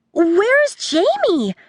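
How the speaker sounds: put-on high, slow, anxious girl call; loud girl asking. A narrator's reading. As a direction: call out searching. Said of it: "asked the loud girl".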